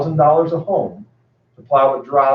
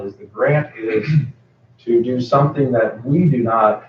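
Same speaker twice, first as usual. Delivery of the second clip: usual speaking voice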